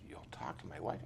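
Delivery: low, hushed disbelief; a quiet, stunned question; astonished whisper. Retold or imitated. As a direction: whisper it, unable to believe it. Biker stunned by the offer.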